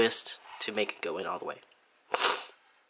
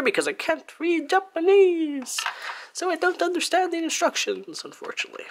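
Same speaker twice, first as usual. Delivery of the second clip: mock wail. Real, not acted. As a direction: wail it in playful despair. Mock lament over unreadable instructions.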